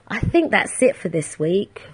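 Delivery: ordinary talk, nothing marked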